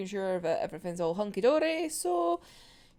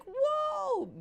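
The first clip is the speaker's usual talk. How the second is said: whoa. Real, not acted. Reacting to rude interviewer.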